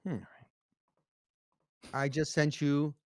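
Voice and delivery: ordinary talk, nothing marked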